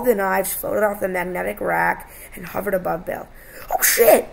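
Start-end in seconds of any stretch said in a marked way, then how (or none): 3.7-4.3 high, loud narrated cry of alarm; alarmed shout. A narrator's reading. Son seeing knives hover over Dad, alarmed shout. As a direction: shout it in sudden alarm, much higher. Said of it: "Jonathan shouted".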